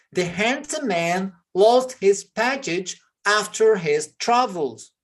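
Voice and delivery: slowed right down, word by word; slow model reading. Shown as a demonstration. Teacher reading slowly to students repeating after him. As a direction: read it slowly so students can copy. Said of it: "slow model sentence for repeating".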